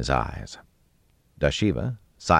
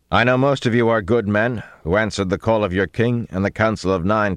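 raised, commanding proclamation; higher, forceful speech of a king; booming; 5 semitones higher, 4 dB louder than usual. A narrator's reading. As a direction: address hidden men so every word carries. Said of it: "they boomed against the wall".

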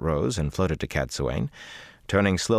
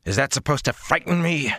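growled; 9 semitones higher than usual. Acted. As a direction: throw it back at her with rising anger. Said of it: "he growled".

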